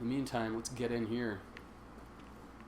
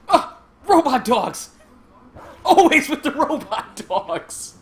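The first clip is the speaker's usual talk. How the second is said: loud, higher hammed-up cry of exasperation, laughing on the last words; mock exasperation; 12 semitones higher, 13 dB louder, slower than usual. Streamer mock-yells at the game's robot dogs, loud and laughing. Exasperated outburst at game enemies.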